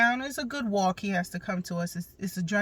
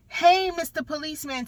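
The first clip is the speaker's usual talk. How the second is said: put-on much higher, bright child greeting; cheerful little boy; 8 semitones higher than usual. Mom imitates her son happily greeting the cop, high and childlike. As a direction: chirp it like an eager little kid.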